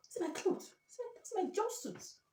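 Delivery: high, slow whining wail. Real, not acted. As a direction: wail over the jumpsuit. Wailing over favourite jumpsuit.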